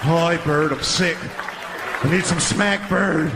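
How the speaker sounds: lightly voiced strung-out elephant begging; a touch of a sick addict; a hint of junkie Snuffy.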